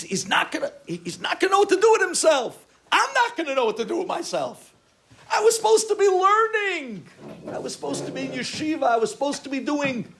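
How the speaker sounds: loud, high, frantic wailing; panicked. Retold or imitated. Son dreading his father seeing him, loud high panic.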